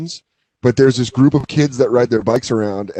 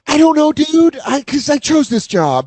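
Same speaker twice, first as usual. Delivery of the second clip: whiny teacher; 13 semitones higher than usual. Pretend. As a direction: whine like a helpless substitute. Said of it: "You're like".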